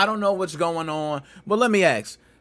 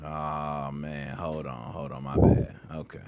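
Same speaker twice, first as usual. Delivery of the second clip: much lower, softer, deep radio voice; deep voice hour; 10 semitones lower, 7 dB quieter than usual. Real, not acted. Host settling into his deep voice hour, low and soft. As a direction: sink low and soft like late-night radio. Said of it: "my deep voice hour".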